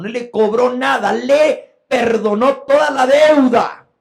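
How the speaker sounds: high, forceful proclamation, rising in volume; emphatic preaching. Real, not acted. Preacher reminds listeners of the forgiven servant, loud and emphatic. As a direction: build to a loud, stretched-out finish.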